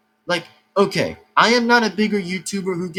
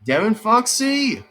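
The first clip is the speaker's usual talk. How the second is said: slow, higher mock yell of his name; imagined girlfriend yelling; 5 semitones higher, 3 dB louder than usual. Imagined girlfriend bursting in on him, yelling his name in mock anger. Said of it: "she's gonna start yelling by saying".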